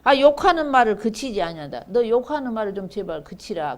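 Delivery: forceful recited complaint, easing at the end; acted protest. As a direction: declaim the hearers' objection to the prophet. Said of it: "그만해라고 그러는 거예요".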